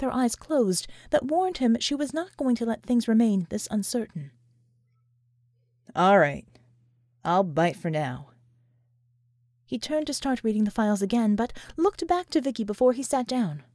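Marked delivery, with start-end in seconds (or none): 5.9-8.3 a lower, slower man giving in for now; grudging John. A narrator's reading. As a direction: concede reluctantly, taking your time.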